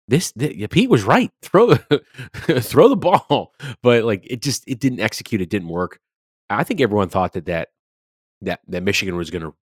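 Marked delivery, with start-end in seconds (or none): 0.1-3.5 high, loud mock cheer, laughing through it; hammed-up fan yelling throw the ball; mock excited. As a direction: cheer it on sarcastically, much higher and louder. Mock cheering the play call.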